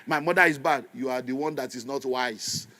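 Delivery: usual speaking voice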